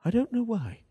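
high, swooping posh English voice; mock upper-class English, high and plummy; posh Englishman. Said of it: "He's a posh man".

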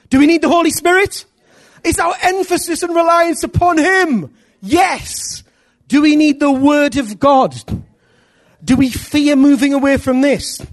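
high, loud prophetic proclamation; preaching at full force. Acted. Preacher to congregation, rising full-force proclamation. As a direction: proclaim the coming revival.

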